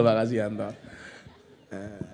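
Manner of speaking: normal talking voice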